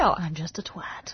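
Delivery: slow teasing whisper. Real, not acted. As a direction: whisper it like a cheeky aside. Joking self-put-down aside.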